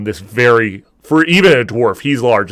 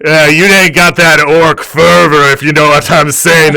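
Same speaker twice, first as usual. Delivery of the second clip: louder, higher cocky brag; orc bartender boasting; 5 semitones higher, 8 dB louder than usual. Acted. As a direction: show off to the barmaid.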